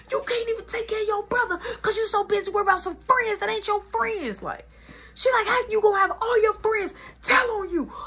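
high, crying, rushed scolding; tearful rant. Retold or imitated. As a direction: scold your son through tears. Mom ranting in tears.

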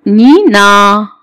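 "نينا" drawn out as nii-naa; syllable-by-syllable demo. Shown as a demonstration. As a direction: stretch both syllables of "نينا" slowly. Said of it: "وبعد كده ايه؟".

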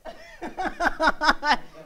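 high chuckle in a run; laughing. Real, not acted. Recalling how dad mocked a cartoon, laughing. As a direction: break into a run of giggles.

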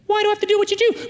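put-on very high, loud, fast kid voice; mimicked child's rapid protest. Child protests to lecturing dad.

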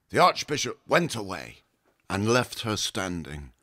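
ordinary delivery, nothing marked

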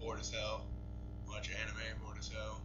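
bored refrain. Real, not acted. Repeating refrain, falling.

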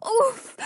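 pained grunt. Acted. Lands hard on her side.

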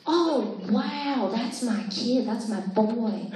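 slow, awed laid-back drawl; Owen Wilson impression. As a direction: stretch out the wonder lazily, amazed at everything. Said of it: "sitting through my impersonations".

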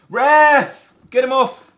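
loud, high outraged yell; furious appeal; angry shout at ref. Real, not acted. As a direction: bellow at the referee to send him off. Demanding red card from ref.

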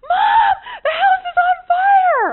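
put-on high, loud, desperate yell of a child; retold frantic cry for mom; panicked shout. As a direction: scream it like the house is burning. Son bursting in, house burning.

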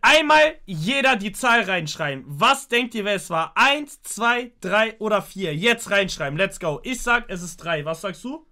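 loud, high hype host; rally everyone to vote; hyped voting call. Real, not acted. Ruft zur Abstimmung auf. (laut, hoch, aufgedreht zur Abstimmung rufen)

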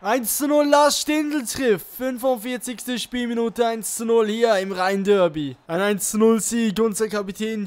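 high shouted celebration; excited goal call. Real, not acted. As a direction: yell it out like a goal.

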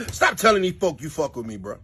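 normal talking voice

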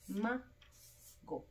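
"mago" slowly split, MA, pause, go; split into syllables. Shown as a demonstration. Teacher breaking word up for pupils, "mago" split MA, go. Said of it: "la sílaba tónica".

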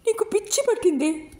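very high, strained tearful mother; nearly in tears, pitch way up. A narrator's reading. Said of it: "అన్నది రుక్మిణి ఏడుపు గొంతుతో". (ఏడుపు గొంతుతో ఎత్తైన స్వరం)

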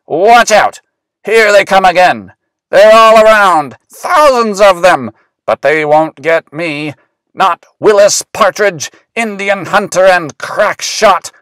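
high, loud, thrilled battle yelling; excited shouting. A narrator's reading. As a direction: shout over the charging enemy. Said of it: "cried Willis".

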